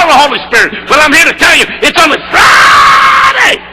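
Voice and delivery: full-force preaching. Shown as a demonstration. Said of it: "I'll give you one more shot".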